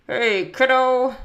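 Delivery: put-on loud, slow call to the kid; lightly voiced dad, drawn out and loud; dad's greeting. A narrator's reading. Dad greets his kid from the loft, loud greeting. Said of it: "with the nails in his mouth".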